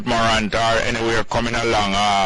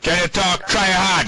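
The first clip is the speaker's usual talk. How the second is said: raised voice over a bad line; 8 semitones higher than usual. Real, not acted. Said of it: "try hard if you talk louder".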